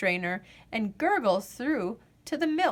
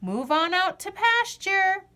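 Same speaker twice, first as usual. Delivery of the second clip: calling out; 9 semitones higher, 4 dB louder than usual. Acted. Calling cows out.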